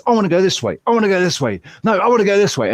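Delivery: lightly voiced wayward dog. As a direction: voice a headstrong dog pulling away, lightly. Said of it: "I've got Alfie, who's off in different directions".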